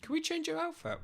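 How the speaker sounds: squeaky high voice. Pretend. Dress-up avatar voice.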